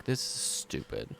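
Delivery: whispered sullen mutter; grumbling teenager. Acted. Drow teen grumbling about her job. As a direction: grumble it under your breath. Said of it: "she's like".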